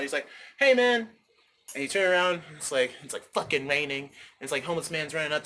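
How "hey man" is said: higher, louder call to a stranger; mild yell to get someone's attention. Acted. Homeless man hailing a passerby, calling out. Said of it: "someone yells out".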